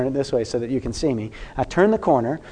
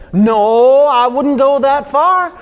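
a higher, louder put-on voice, slow and hedging; mimicking the man; 9 semitones higher, 5 dB louder, much slower than usual. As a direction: play the backpedalling passenger in a higher voice. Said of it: "He said".

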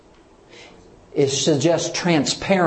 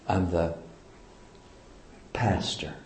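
self-important hushed claim; 7 semitones lower, 11 dB quieter than usual. Acted. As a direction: imitate quiet self-importance, much softer and lower. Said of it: "I may not say it like that".

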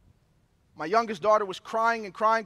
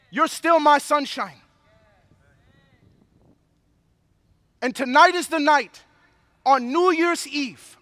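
rising, louder preacher's cry, hammering each line; preaching at full force; 2 semitones higher, 6 dB louder than usual. Acted. Pastor preaching to his New Year's Eve congregation, full force. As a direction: build the promise like a revival sermon.

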